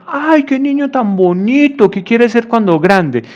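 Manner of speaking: put-on high sing-song visitor fussing over a child; cooing visitor. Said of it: "llegaba y le decía al niño". (visita mimando al niño con voz aguda)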